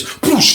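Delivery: high "poosh" of a door; vocal sound effect; imitated door noise. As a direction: mimic the back door bursting with a high whoosh.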